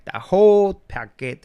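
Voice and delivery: high, loud and slow on whole paquete; loud, drawn-out emphasis; emphatic outburst. Retold or imitated. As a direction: stretch it out in disbelief. Emphatic outrage.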